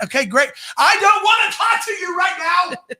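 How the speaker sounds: mock exasperated shout; annoyed inner retort. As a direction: snap back in your head, fed up. Inner reply to chatty stranger.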